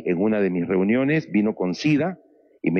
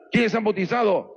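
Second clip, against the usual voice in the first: calling out; 11 semitones higher than usual. Retold or imitated. Preacher questions the prisoners.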